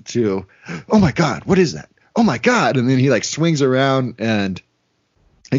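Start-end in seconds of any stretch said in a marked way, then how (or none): 0.9-2.8 a hint of panic, voice jumping higher; mock panic. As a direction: imitate him suddenly freaking out. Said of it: "literally goes from ... to".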